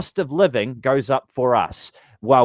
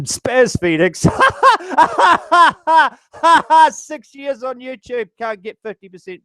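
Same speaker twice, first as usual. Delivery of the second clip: high, loud burst of real laughter; laughing; 13 semitones higher, 5 dB louder than usual. Real, not acted. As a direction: crack up at your own joke. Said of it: "I had to laugh at that".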